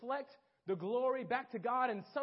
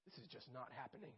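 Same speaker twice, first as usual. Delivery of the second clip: despairing aside; 7 semitones lower, 16 dB quieter than usual. Retold or imitated. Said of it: "So I was like".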